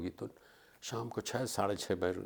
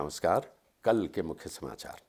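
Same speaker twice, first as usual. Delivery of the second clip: lower, measured bulletin opening; newsreader voice; 5 semitones lower than usual. Shown as a demonstration. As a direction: announce it like a TV anchor. Reading news at audition.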